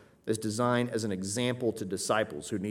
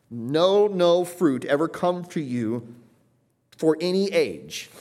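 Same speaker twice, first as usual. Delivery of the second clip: loud, high and slow, opening "No" hit hard; an unbending final verdict; absolute curse; 6 semitones higher, 7 dB louder, slower than usual. Shown as a demonstration. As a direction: declare it with full force. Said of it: "supposed to be absolute".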